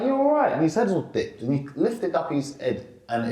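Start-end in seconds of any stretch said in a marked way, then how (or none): 0.0-0.5 much higher, gently checking in; concerned dad. Retold or imitated. Father on the tube asking his silent son if he's okay, gently high.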